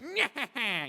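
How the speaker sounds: high, nasty cartoon cackle; Skeletor. Shown as a demonstration. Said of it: "just have this voice that's".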